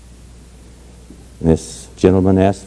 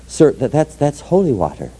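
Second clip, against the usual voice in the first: lightly voiced old lady, raised pitch; stammering old lady; 6 semitones higher than usual. Old Irish lady explaining bottle to customs agent, lightly acted stammer. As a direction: stammer out a mock-innocent excuse, pitched up.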